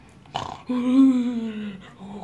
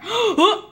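sharp high gasp; 6 semitones higher than usual. Pretend. Reacts to lost house.

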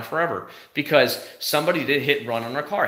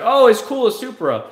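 mock reviewer; 9 semitones higher, 6 dB louder than usual. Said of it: "They're gonna be like".

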